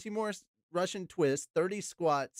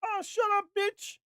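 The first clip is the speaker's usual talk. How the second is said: high-pitched hammed-up yell; acted yell; 4 dB louder than usual. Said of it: "Then I get to yell".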